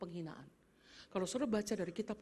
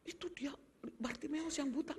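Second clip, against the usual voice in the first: hushed, secretive whisper; gossipy whisper; 6 semitones higher than usual. Retold or imitated. People whispering rumours about the healer. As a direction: whisper it like juicy neighbourhood news.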